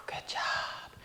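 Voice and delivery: out of breath, whispered praise; breathless whisper. Real, not acted. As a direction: whisper it, winded. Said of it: "even I'm out of breath right now".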